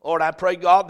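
ordinary delivery

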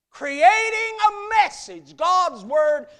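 preacher calling out at full force; preaching climax. Real, not acted. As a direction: ring out each word from the pulpit.

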